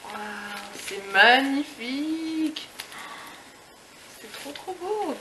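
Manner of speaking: slow, high, breathy wonder; drawn-out amazed gasp of delight; marvelling slowly at a present; awed. Real, not acted. Awed at the gift's beauty.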